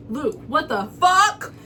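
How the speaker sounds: loud, rising disbelief; shocked outburst. Retold or imitated. To Luke on phone after offer, shocked shout. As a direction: build to a shouted curse on the last word.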